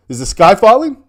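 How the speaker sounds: hammed-up worried cry; mock alarm. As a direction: play at being alarmed, voice jumping up. Acting out a worried group question.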